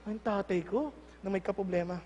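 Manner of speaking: low, soft remark about father; observing child. Acted. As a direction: say it quietly, as a watching child. Child quietly observes troubled father. (mahinang puna ng anak)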